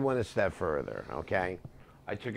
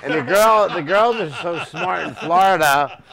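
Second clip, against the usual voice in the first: laughing; 7 semitones higher, 10 dB louder than usual. Real, not acted. Laughing through punchline.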